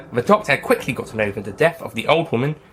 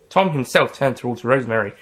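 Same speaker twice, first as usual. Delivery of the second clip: usual speaking voice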